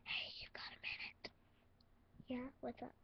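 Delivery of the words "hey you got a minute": secretive whisper; quiet and secretive. Acted. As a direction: lean in and whisper the question. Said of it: "all quiet and secretive".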